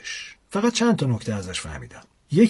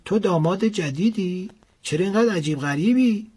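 put-on old woman; 6 semitones higher than usual. A narrator's reading. Old woman questions stranger.

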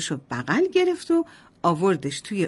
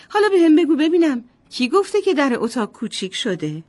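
higher, louder, delighted mother; a mum proud her son has grown; happy mother; 6 semitones higher, 3 dB louder than usual. A narrator's reading. Mother delighted her son grew taller, higher and louder. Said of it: "با خوشحالی بهش گفت".

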